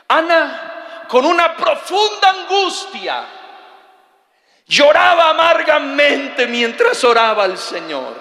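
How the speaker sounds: high, loud preacher's cry; fiery preaching. Acted. Preacher to congregation, loud and fiery.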